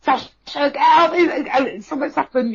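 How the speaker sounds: high, urgent mock scream; retold screaming. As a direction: imitate screaming a warning, without full volume. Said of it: "I'm screaming at her".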